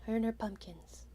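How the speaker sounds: whispered aside, breathy and hushed. Real, not acted. Player whispering about the witch's pumpkins. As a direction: hush it like a secret.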